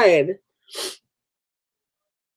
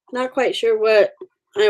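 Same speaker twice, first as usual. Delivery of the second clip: usual speaking voice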